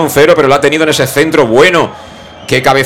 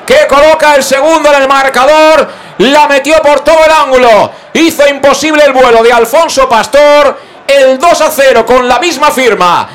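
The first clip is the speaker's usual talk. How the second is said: roaring goal call, much higher and held long; breakneck goal commentary; 8 semitones higher than usual. Real, not acted. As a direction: shout the goal like a radio commentator. Goal scored, radio commentator erupts.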